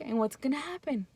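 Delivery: normal talking voice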